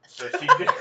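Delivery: high laughing squeal; breaking into laughter. Real, not acted. Teller laughing at own joke, high squealing laugh. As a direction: crack up at your own joke.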